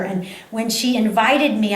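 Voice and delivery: ordinary talk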